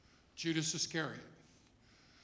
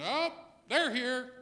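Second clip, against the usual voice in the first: mock surprise; 7 semitones higher, slower than usual. Noticing a loud person arrive.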